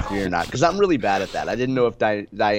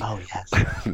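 public radio host; 3 semitones lower, 4 dB quieter than usual. Acted. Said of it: "my Saint Louis Public Radio voice".

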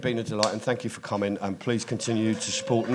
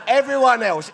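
usual speaking voice

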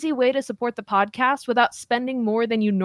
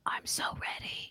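hushed excited whisper; 16 dB quieter than usual. Acted. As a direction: breathe it in a whisper. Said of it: "Why are we whispering?".

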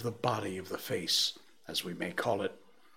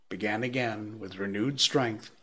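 ordinary delivery, nothing marked

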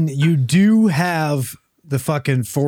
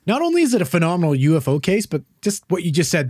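normal talking voice

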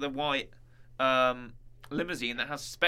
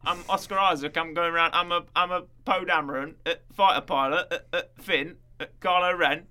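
louder, hammed-up British bloke with stutters; hesitant, rambling put-on British voice; mock British accent; 3 semitones higher, 5 dB louder than usual. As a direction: do a silly British accent, stumbling on names. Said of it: "when Stephen Grant was talking".

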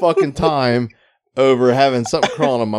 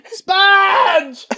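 shouted name. Acted. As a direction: yell it, much higher. Calling out a name.

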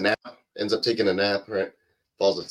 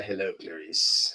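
Hannibal Lecter voice; 4 semitones lower, 5 dB quieter, slower than usual. Retold or imitated. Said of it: "Almost like a".

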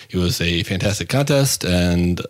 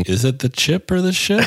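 puzzled, pitched up high; genuinely stumped, ask it high; baffled question; 8 semitones higher than usual. Real, not acted.

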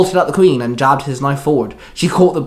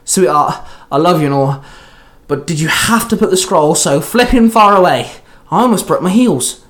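louder, higher exasperated complaint; exasperated snapping; 4 semitones higher, 5 dB louder than usual. A narrator's reading. Eli complains to the queen about the faraway scroll, snapping. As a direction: snap it, building from sweet preface to loud gripe. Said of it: "Eli snapped at her".

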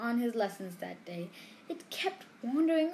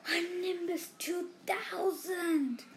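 drawn-out, higher envious moan; 7 semitones higher, slower than usual. A narrator's reading. Ron moaning enviously over Harry's new broom, a slow high moan. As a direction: moan it with longing. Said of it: "Ron moaned enviously".

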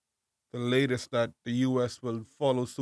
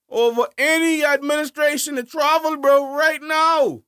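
much higher and louder outraged tirade; angry rant; 10 semitones higher, 5 dB louder than usual. Real, not acted. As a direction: rant hard about the hypocrisy, slowing at the end. Ranting about prime minister's hypocrisy.

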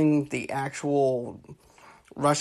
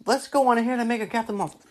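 quick, higher-pitched bright idea; mock eager; 7 semitones higher, faster than usual. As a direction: pitch it fast, as if suddenly inspired. Imagined executive has sudden idea.

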